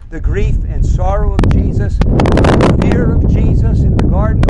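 loud and high, crying it out; impassioned preaching. Real, not acted. Preacher crying out about Jesus in the garden, loudly. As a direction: preach the grief at full force.

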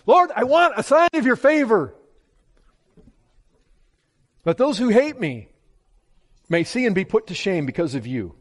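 a preacher voicing a psalmist's bold plea to God; bold prayer. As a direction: ask boldly and directly, full voice. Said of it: "he's so bold".

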